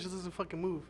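ordinary talk, nothing marked

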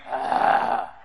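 voiceless drawn-out "ah"; imitated demonic rasping breath; possessed person's hiss. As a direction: let out a long unvoiced creepy exhale. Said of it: "looked at me and went".

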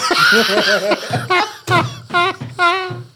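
roaring laughter. Real, not acted. Big laugh at the joke.

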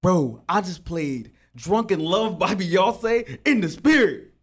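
loud, slow, proud brag; excited bragging. Retold or imitated. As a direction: hype it up like your best night ever. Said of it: "I'm like".

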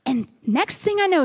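ordinary talk, nothing marked

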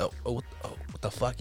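whispered in disbelief; unvoiced, stunned whisper. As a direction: breathe it out, stunned. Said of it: "he's just kinda".

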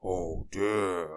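put-on, drawn-out tramp voice; kindly tramp. A narrator's reading. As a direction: sympathize slowly as a kindly vagrant. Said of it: "he was a kindly tramp".

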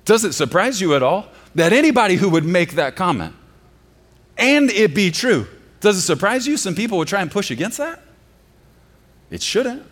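loud and high, pressing the question; preaching at full force. Acted. Pastor challenging his congregation, loud and rising. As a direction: drive the challenge home.

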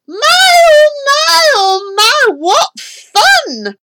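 very high, loud whoop; shrill-pitched dentist having a ball; gleeful yell. A narrator's reading. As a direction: yell it with mad delight.

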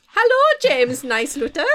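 put-on slow playful Dutch accent greeting. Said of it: "That was a Dutch accent".

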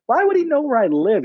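high-pitched, incredulous question; baffled disbelief. Retold or imitated. Pastor thinking about the foster kid's claim, high disbelief. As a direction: puzzle over it in a high voice. Baffled inner reaction to child.

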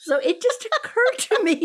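high, laughing talk; words through a burst of laughter. Real, not acted. Laughing after the joke.

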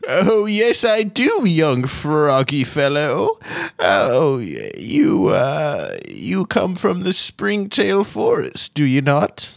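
put-on high, slow, creaky old gnome wizard, cheerfully cryptic. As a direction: play a quirky ancient gnome teasing a newcomer.

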